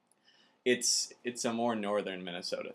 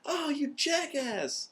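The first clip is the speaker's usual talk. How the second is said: much higher, stretched mock scream of a raging kid gamer; retold gamer scream; slower than usual. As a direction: screech it like a kid losing at Halo. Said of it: "I would scream at the top".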